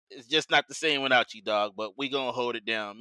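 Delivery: ordinary talk, nothing marked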